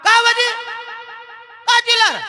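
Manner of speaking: high-pitched irritated wife's retort; mimicked exasperated housewife; annoyed wife. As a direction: call back crossly in a high voice. Wife answers crossly again.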